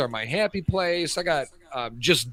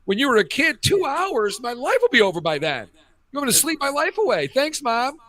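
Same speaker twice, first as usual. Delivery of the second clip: put-on high, fast, indignant child protest; exasperated child arguing with mom about naptime; kid's mock outrage; 8 semitones higher, 3 dB louder than usual. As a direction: whine like a kid refusing a nap. Kid voicing outrage at naps.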